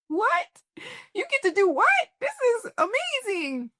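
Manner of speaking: put-on high, squealing child's wonder; amazed little girl. Little girl amazed at her grown-up life. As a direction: gasp it like a kid who can't believe it. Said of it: "she certainly feels like".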